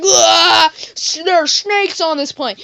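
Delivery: hammed-up high, loud alarm; scream, then cry out the warning slowly; panicked scream. Everybody panicking at snakes, acted.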